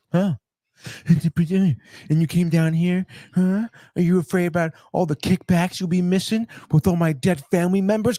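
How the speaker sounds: threatened woman turning threatening. Acted. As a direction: act out her turning the threat back on him. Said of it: "and she just goes".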